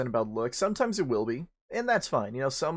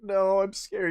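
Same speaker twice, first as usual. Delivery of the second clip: higher, timid refusal; mock scared lady; 7 semitones higher, slower than usual. Lady refusing again, mock scared and high. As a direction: shrink back like a frightened woman. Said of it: "She's like".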